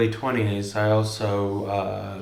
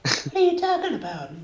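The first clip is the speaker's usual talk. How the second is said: put-on much higher, squeaky kid voice; high-pitched kid voice; 4 dB quieter than usual. Friend asks for his kid voice, he does it high. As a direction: talk like an indignant little kid. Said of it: "high-pitched kid one".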